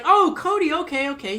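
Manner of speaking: high, loud put-on manager voice; new manager's voice. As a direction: pitch the boss up high and fake-friendly.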